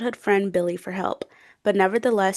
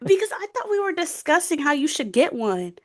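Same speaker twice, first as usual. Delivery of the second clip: mock indignation; 6 semitones higher than usual. Real, not acted. Mock accusation over a secret Kindle.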